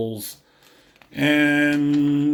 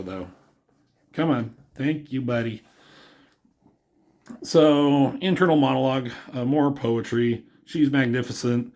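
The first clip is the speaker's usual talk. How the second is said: ordinary talk, nothing marked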